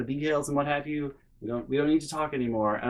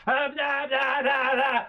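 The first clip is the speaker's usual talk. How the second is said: high mock yelling in nonsense syllables; mom yelling over; 11 semitones higher, slower than usual. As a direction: cut in with high-pitched blahs. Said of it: "my mom's yelling over her".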